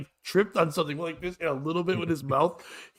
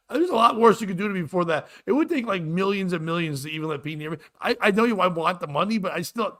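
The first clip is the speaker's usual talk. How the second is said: ordinary talk, nothing marked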